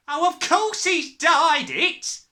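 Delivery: slow, high, loud exclamation; crying out. A narrator's reading. Mother sure her husband dyed it, crying out. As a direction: cry it out emphatically, stretching words. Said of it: "the mother cried".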